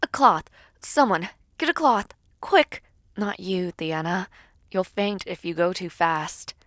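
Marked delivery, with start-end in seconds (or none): 0.0-2.8 urgent call. Acted.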